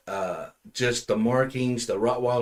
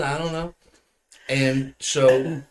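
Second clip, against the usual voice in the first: ordinary delivery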